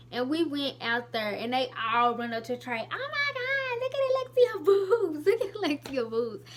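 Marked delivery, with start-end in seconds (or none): 2.9-6.4 much higher, squealing mock gossip; excited friends. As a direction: imitate girls squealing to a friend. Friends shouting to Trey.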